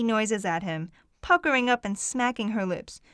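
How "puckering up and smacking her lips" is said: ordinary delivery, nothing marked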